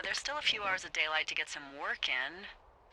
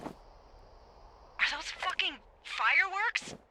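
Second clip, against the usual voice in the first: high, stretched-out incredulous question; appalled disbelief; 12 semitones higher, much slower than usual. Acted. Lookout sees fireworks in fire season, appalled disbelief. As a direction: draw out each word in outraged astonishment.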